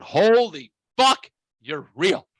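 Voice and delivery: loud, high, drawn-out disbelief; astonished. Retold or imitated. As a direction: retell it as a stunned outburst. Retold shock seeing the wife.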